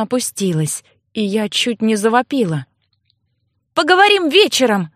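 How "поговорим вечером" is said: calling out. Acted. Calling through the door.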